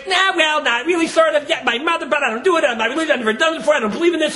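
high, hurried excuses; flustered refusal. Acted. Passenger refusing to join prayer.

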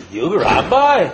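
put-on old Syrian chacham's accent, gruffly dismissive; mock Syrian accent, teasing disbelief. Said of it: "So in his Syrian accent".

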